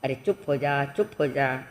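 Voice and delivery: low, soft hushing; acted gentle father's hush; soothing a baby. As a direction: lull a child while patting. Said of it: "वो थपथपाता है बेटे को".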